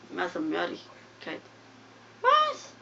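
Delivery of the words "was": very high disbelieving squeak; can't believe what just happened; shocked Was. Real, not acted. Gamer yelps Was in high disbelief.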